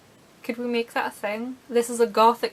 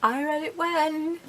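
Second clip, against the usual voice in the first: fangirl voice; 7 semitones higher than usual. Retold or imitated. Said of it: "one of those people like".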